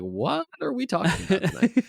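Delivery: high-pitched, baffled self-quote; mock confusion. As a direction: act out being totally thrown. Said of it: "I was really confused".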